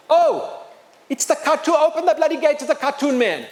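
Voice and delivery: high-pitched, excited imitation of a guard; excited recognition. Soldier ordering the gate opened, excited recognition. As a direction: exclaim as a soldier spotting someone famous.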